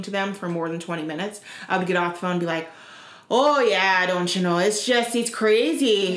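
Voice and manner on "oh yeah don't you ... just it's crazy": put-on picked-up Minnesota accent, stretched vowels; exaggerated Minnesota lilt. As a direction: chat like a Minnesotan. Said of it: "lived in Minnesota".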